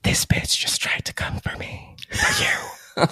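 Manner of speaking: hushed dramatic whisper. Retold or imitated. Aunt's shocking text, whispered dramatically. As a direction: breathe it as a dramatic secret.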